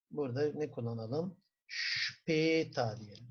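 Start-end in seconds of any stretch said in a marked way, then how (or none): 1.7-3.0 Später said slowly, Şpeta; slow model. Shown as a demonstration. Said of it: "bir telaffuz yapalım". (Später'i yavaşça Şpeta diye)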